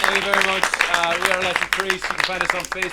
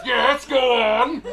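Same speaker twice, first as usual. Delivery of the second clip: slow god voice; 5 semitones higher, 4 dB louder, much slower than usual. Acted.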